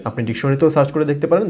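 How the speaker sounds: usual speaking voice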